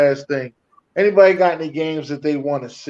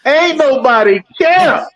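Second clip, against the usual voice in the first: high, loud, drawn-out holler; catchphrase shout; 10 semitones higher, 7 dB louder, much slower than usual. Shown as a demonstration. Co-host shouting his catchphrase when friends beg for it. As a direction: holler it out slowly on request. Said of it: "Say it, [name]. Please say it".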